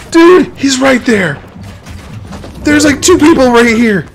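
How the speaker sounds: high, loud panicked shrieks; panicking gamer. Real, not acted. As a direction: freak out as they close in. Enemies building right on him.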